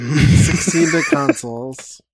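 "Sixteen-bit consoles" said slowly and deliberately; slow correction. Shown as a demonstration. Co-host slowly correcting the misread phrase. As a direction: spell it out slowly for him. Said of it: "correcting the misreading".

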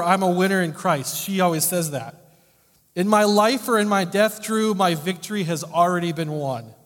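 high, loud, triumphant proclamation; fervent preaching. Acted. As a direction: proclaim her victory. Passionate preaching of her motto.

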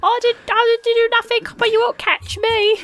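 a plucky street orphan daring them; Victorian orphan voice. Acted. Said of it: "the Victorian orphan voice".

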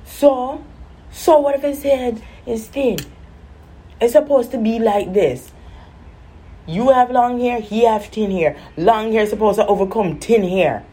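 lightly voiced Caribbean mom; mom's Caribbean voice. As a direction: argue it like a stubborn Caribbean mom. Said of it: "So she's like".

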